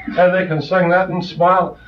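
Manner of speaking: ordinary talk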